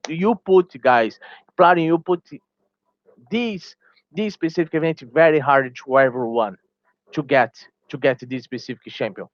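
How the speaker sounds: ordinary talk, nothing marked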